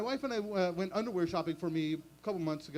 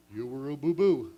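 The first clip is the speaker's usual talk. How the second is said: low, louder cartoon bear punchline; Yogi Bear voice; 4 semitones lower, 8 dB louder than usual. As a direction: deliver the insult as Yogi. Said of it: "in cartoon voices".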